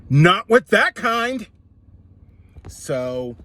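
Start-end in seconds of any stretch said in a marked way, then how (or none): 0.1-1.5 slow, loud, higher shout at the rabbit; loud together. Acted. Squirrels shout at the rabbit, slow loud refusal. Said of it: "they both say out loud".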